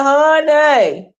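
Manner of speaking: high, loud shrieked leave; mock yell. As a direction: yell it back in mockery. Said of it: "I said".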